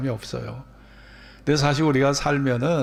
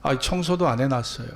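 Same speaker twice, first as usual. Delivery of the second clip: ordinary talk, nothing marked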